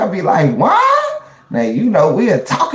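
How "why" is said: high wailing why; a sudden high why to heaven; fired-up cry. Retold or imitated. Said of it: "I get fired up with God".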